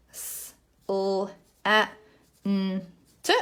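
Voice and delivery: letter sounds of "slant" one by one, with gaps; teacher segmenting a word sound by sound. Shown as a demonstration. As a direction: sound out S-, l-, a, n, t as separate phonemes. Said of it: "So I've sounded out my word".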